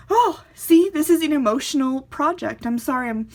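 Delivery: choking up while apologising; breaking into real tears mid-sentence; tearful. Real, not acted. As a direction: voice cracking high with tears, real crying.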